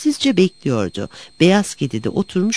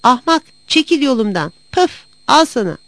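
higher-pitched, mildly angry outburst; angry cat shout; 6 semitones higher than usual. A narrator's reading. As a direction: scold and strike out at a rival. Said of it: "diye bağırdı".

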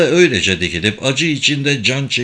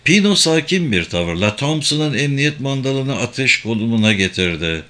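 plain reading voice

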